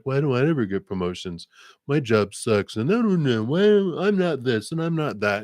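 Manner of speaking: high-pitched imitation of a complainer; mock whining. Mimics a self-pitying partner's complaint.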